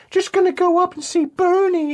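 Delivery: high sing-song woman's voice; girlfriend's voice. Retold or imitated. Retelling the movie girlfriend going upstairs, high sing-song. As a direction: chirp it like a cheerful girlfriend. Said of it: "she's like".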